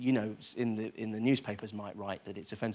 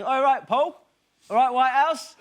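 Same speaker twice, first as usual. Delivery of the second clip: character voice; 12 semitones higher, 9 dB louder than usual. Retold or imitated. Greeting Paul Whitehouse in character.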